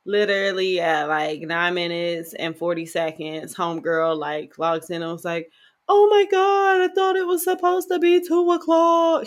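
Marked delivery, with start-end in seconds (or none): 5.9-9.3 mock her with a high prim voice; exaggerated imitation of the late therapist. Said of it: "Why are you using this voice?".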